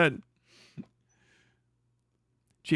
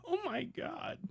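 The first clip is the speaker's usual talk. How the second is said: stunned gasp; 12 semitones higher, 14 dB quieter, slower than usual. Real, not acted. Reacting to lewd thumbnail.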